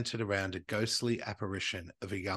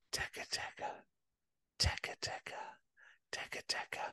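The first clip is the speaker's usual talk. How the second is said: eerie whisper; 11 dB quieter than usual. Pretend. Spooky ghost sound whispered.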